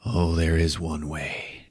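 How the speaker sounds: soft, slow mock-grave line; a hammed-up, hushed heroic reveal; solemn reveal. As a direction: drop low and slow for the big reveal. Hero reveals secret weapon.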